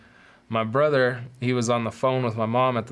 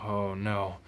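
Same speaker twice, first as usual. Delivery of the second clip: soft, sinking oh no; dread; 3 semitones lower, 7 dB quieter than usual. Retold or imitated. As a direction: caught out, barely say it. Cop reveals the gun box.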